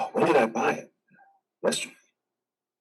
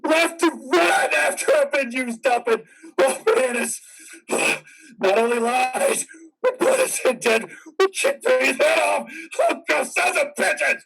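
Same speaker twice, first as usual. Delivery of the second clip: furious yelling; 5 semitones higher than usual. Acted. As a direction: scream your outrage at the whole world. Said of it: "I'll yell if I want to".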